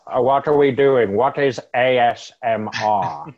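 bewildered question, loud then easing off; Alfred. Acted.

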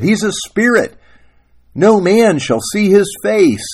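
high, loud proclamation; insistent acted rebuke; righteous wife. Wife lectures husband in quarrel.

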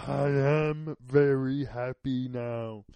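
loud, very slow character voice; hammed-up happiness, each word drawn out; mock happy voice. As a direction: declare it slowly and loudly in a silly put-on voice. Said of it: "when I'm feeling really happy".

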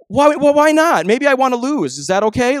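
playfully indignant comeback; defiant retort. Retold or imitated. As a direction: push back like a cheeky sibling. Speaker answering his brother defiantly.

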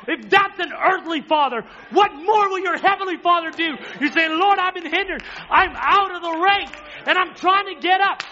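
loud, high preacher's shout; full-force preaching. Acted. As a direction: drive the point home to the congregation.

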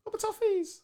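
high sing-song mock pity; mock sympathy. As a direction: coo it with fake sympathy.

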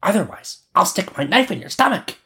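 put-on high-pitched little girl menace; robber girl threatening. A narrator's reading. Robber girl threatens Gerda with a knife, high-voiced. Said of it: "the rubber girl said".

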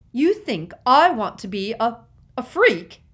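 loud, high, angry question; scornful outburst. A narrator's reading. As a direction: spit the insult at your sister. Sisters quarrel at platform.

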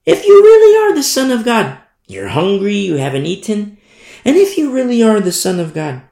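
high, loud tempter's challenge; taunting Satan. Acted. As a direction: play the devil goading with a high-pitched dare. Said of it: "he goes to Jesus Christ and says".

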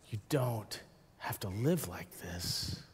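low, soft, slow mantra; subdued, tender echo of a friend; hushed repetition. Retold or imitated. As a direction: say it quietly and slowly, letting it sink in. Friend's words recalled as a mantra.

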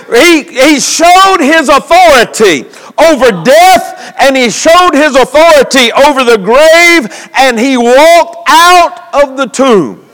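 emphatic sermon delivery in measured phrases; preaching. Real, not acted.

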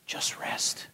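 breathy whisper, hushed and slow; whispered voice of God. Retold or imitated. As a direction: whisper it like God speaking softly. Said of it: "And I remember him saying".

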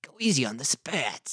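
soft-spoken New York dummy voice; New Yorker. Acted. Co-host retrying the dummy's line, New York accent. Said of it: "He's like a real New Yorker".

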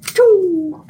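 high loud choo; arrow shot sound. Acted. Rogue player voicing his arrow's shot, high and loud. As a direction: make the sound of an arrow firing. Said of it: "and be like".

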